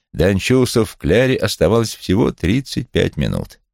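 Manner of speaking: ordinary delivery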